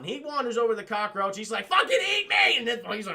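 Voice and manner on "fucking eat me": high, loud acted dare; mock defiant shout. Agent daring the giant cockroach, mock defiant shout. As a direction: shout a hammed-up challenge.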